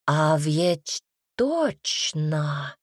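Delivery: very slow, stretched realisation; drawn out. A narrator's reading. Gloria realises Adele can help, drawling it. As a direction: draw each word right out as it dawns. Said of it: "протянула Глория".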